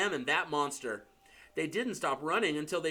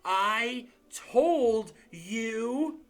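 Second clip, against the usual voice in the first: slow, gasping, higher pitched; out of breath; 7 semitones higher, 4 dB louder, much slower than usual. A narrator's reading. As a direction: wheeze it out with a stitch in the side. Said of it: "clutching at the stitch in her chest".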